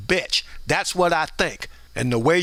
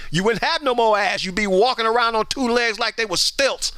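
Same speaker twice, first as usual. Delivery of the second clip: ordinary talk, nothing marked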